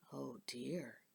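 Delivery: hushed concern; soft worried whisper; whispered oh dear. Acted.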